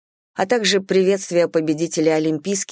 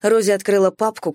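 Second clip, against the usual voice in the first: plain reading voice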